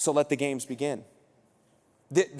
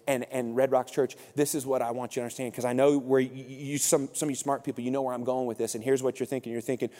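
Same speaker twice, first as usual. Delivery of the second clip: normal talking voice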